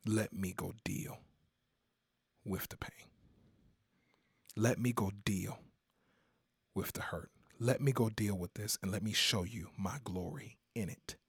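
hushed, tender Jesus voice; whispered Jesus. Acted. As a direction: breathe the words of Jesus gently.

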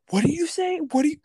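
high, loud mock yell at the owner; imitating yelling. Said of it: "these dudes were, like, yelling".

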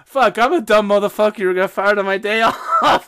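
loud, laughing movie-quote rant. Retold or imitated. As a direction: shout it while cracking up.

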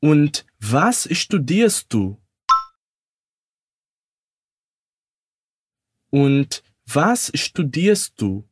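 slow, careful question for repeating; slow learner model; slow demo. Shown as a demonstration. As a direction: ask it slowly so they can echo. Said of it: "Repete comigo".

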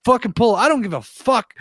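high-pitched, exasperated mock yell; acted-out panicky anger; retold pissed-off outburst. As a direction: re-enact losing it, pitch way up. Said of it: "I'm getting pissed".

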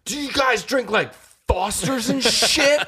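high, slowed drunken mock voice; drunk Nick. Bouncer imitating trashed regular quizzing Australians, drunken excitement. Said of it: "He's like".

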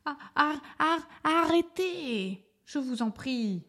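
loud, high, stammered begging; desperate drawn-out pleading cry; stuttering plea. A narrator's reading. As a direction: beg them to stop, stuttering on "arrêtez". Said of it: "A supplia".

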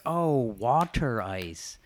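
slow, drawn-out realizing "Oh, water ice"; imitating the coworker. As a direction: play the North Jersey coworker finally getting it. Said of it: "he was like".

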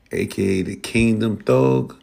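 usual speaking voice

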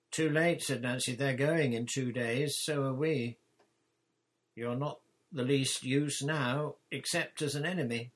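bold Nancy. Acted. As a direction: proclaim it like a bossy pirate chief. Nancy boldly dismisses Flint.

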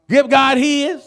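loud emphatic repeat. Acted. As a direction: drive it home to the church. Preacher repeats it forcefully.

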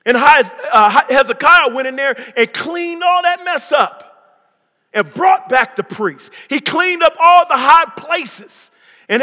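loud, high, driving sermon voice; full-force preaching. Acted. Pastor preaching loudly to the congregation, full force.

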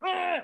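high, exasperated groan of annoyance; irritated ugh. Retold or imitated. Mother groaning at her whinging kid, exasperated. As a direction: let out a fed-up ugh.

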